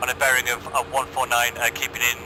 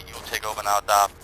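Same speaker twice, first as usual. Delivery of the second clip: ordinary talk, nothing marked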